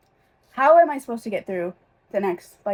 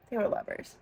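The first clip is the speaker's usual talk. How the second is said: whispered aside; 9 dB quieter than usual. Real, not acted. Confiding the ship to viewers.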